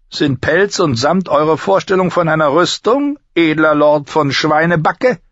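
high-pitched, sneering drill master; mocking master-at-arms. Master-at-arms ridicules the fat new recruit, mocking.

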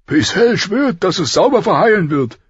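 high, forceful royal voice; blustering king. A narrator's reading. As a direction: pronounce it gruffly, pitched well up. Blustering king on friend's wound.

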